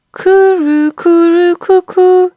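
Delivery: high, loud bird coo; pigeon cooing. A narrator's reading. A pigeon cooing outside, high and loud. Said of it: "sounded from the quiet".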